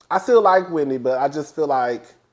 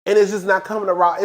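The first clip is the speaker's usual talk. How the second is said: normal talking voice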